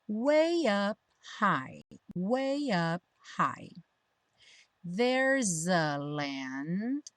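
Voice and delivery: slow, drawn-out model phrases; pronunciation practice. Shown as a demonstration. Teacher leading learners through song lyrics, slow pronunciation practice. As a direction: stretch each linked word out for learners. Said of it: "pra prática da pronúncia".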